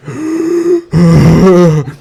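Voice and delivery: heavy exaggerated wheezing gasp; laboured fat-man breathing. Comic imitating his fatter self, laboured gasping. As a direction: heave for air in a hammed-up way. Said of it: "imagine me when I was fatter".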